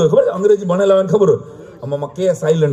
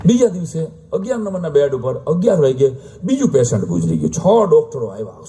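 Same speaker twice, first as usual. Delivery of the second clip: ordinary delivery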